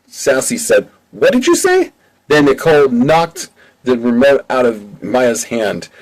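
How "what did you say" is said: much higher, indignant squeal; offended outburst. Acted. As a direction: shoot it back, stung by the insult. Sassy insulted as fatty.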